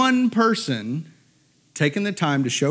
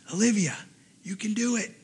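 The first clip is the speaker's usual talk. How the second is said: soft, slightly raised voice close by; hushed encouragement; 4 semitones higher, 6 dB quieter than usual. Retold or imitated. Rider leans down to Olivia, soft encouragement. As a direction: lean in and urge her on softly. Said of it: "leans down and goes".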